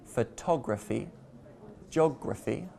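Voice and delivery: "photography" and "geography", stressed on TOG and OG; stress before the suffix. Shown as a demonstration. Teacher demonstrating the rule, "photography" and "geography" stressed before the ending. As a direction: put the weight just before "graphy" in "photography" and "geography". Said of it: "stress the syllable before the ending".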